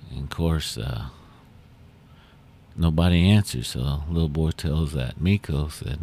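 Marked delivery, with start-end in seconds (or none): none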